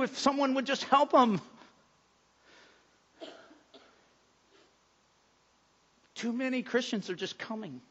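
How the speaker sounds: soft, hushed pastoral prayer. Acted. Pastor praying over his church for workers, soft and hushed. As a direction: pray quietly over the congregation.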